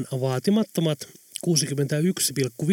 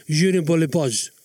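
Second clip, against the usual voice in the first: French grumble. A narrator's reading.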